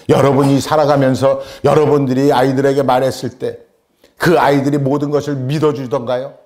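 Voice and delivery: ordinary talk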